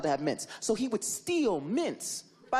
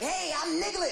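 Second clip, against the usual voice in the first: higher, chirpy greeting; mock cartoon character; 10 semitones higher than usual. Imagined Niglet at Pooh's door, high and chirpy. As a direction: introduce yourself like a cartoon. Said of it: "When it was just like".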